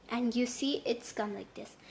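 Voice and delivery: ordinary talk, nothing marked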